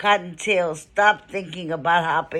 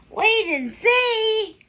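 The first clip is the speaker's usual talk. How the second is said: much higher, slow and firm; little bunny, stubborn; 13 semitones higher, slower than usual. A narrator's reading. Cottontail answering the mocking rabbits, high and slow. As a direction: draw out each word like a determined little girl. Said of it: "But she said".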